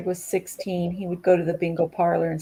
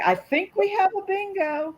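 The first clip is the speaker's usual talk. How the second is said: bingo caller; 13 semitones higher than usual. Retold or imitated. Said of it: "And the caller would say".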